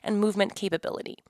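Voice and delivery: bright, upbeat sponsor read. Acted. Host reading a sponsor ad to listeners, upbeat. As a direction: sell the chair to listeners.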